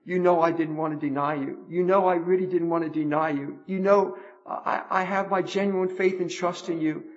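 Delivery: soft, low, earnest plea; pleading Peter. Acted. Peter begging the Lord's forgiveness, soft and pleading. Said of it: "He's really saying".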